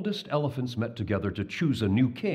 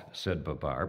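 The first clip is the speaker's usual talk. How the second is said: usual speaking voice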